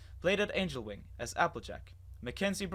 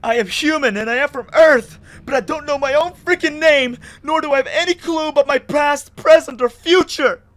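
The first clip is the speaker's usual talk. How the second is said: full-lung scream, much higher and louder; 13 semitones higher, 18 dB louder than usual. Acted. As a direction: scream it at the sky. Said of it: "Now I was screaming from the top of my lungs".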